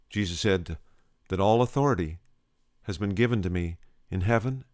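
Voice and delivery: normal talking voice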